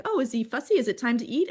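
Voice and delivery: high, fussing guesses; questioning midwife. Retold or imitated. Midwife guessing why the newborn cries, high mock-helpful questions. Midwife quoted in birth story.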